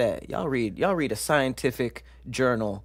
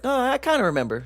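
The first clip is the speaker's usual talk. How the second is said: mock forgetful person; 12 semitones higher, 3 dB louder, faster than usual. Imitating a mildly helped person.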